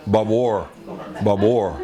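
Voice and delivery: barbed wire with wire said like war. Shown as a demonstration. Scene: Texan showing how ranch folks say barbed wire, wire like war. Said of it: "they said the wire like war".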